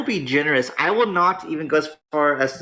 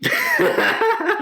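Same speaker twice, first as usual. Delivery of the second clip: a high giggling burst; high laugh. Real, not acted. Streamer amused by a rival's misfortune, high laugh.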